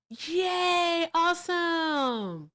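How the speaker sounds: high, drawn-out cheer of joy; delighted squeal. Real, not acted. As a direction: stretch the awesome out, thrilled. Reacting with joy to stream news.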